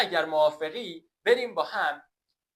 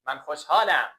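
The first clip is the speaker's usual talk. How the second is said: ordinary talk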